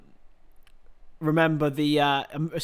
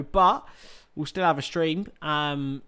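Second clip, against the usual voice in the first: normal talking voice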